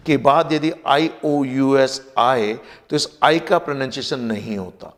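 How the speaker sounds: ordinary talk, nothing marked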